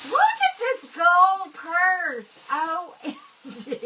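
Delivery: loud, high, drawn-out delight; excited squeal. Real, not acted. Showing viewers the gold purse from Karen, excited squeal. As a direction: burst out loud and high, stretching the words.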